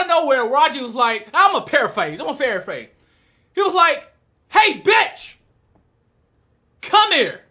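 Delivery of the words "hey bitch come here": high, loud call with a long pause; mock summoning shout. Raji to Mako passing with wheelbarrow, summoning shout. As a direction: call her over brashly.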